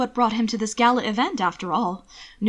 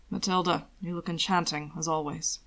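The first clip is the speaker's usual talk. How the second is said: low, soft smooth compliment; a much softer, low courtly purr; 3 semitones lower, 5 dB quieter than usual. Acted. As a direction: caress the words. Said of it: "he purred".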